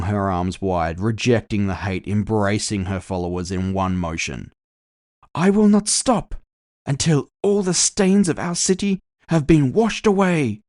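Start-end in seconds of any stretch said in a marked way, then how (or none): 5.3-10.6 much higher, louder ringing orator; fervent vow. Acted. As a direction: vow it to the cheering crowd. Rally speech climax, arms flung wide.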